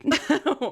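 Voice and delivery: short high, loud laugh; quick high-pitched real laugh. Real, not acted. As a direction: burst out laughing at a quip. Laughs at co-host's Da Vinci quip.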